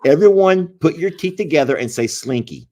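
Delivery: big, emphatic instruction to everyone; loud instruction. Real, not acted. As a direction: call it out loud to viewers. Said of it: "instructing the audience loudly".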